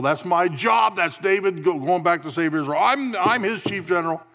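loud, high, swaggering general's boast; proud warrior boast. Retold or imitated. General Joab boasting of his duty, loud and high. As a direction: puff up and brag like a proud chief general. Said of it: "he saw himself as the one appointed".

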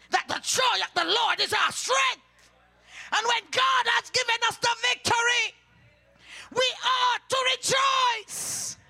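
preacher's rising cry; fiery preaching. Acted. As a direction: thunder the verse out to the church. Preaching rejoicing at full force.

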